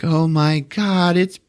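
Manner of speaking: high, slow gushing mom voice; mock mother cooing over cute pajamas; imitating mom. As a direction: coo like a doting mother. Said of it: "the mom is just like".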